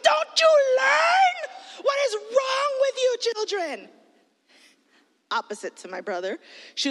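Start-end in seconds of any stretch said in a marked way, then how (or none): 0.0-3.9 high, loud, slow scolding; exasperated yell. Retold or imitated. Big sister yelling at her sister over the text bill. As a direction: wail at the kids in disbelief.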